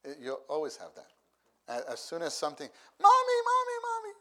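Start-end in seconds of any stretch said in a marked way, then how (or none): 3.0-4.1 very high, loud calling out; a little kid running in calling mommy; child calling mommy. Retold or imitated. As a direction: shout for mom like an excited small child.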